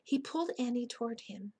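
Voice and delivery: usual speaking voice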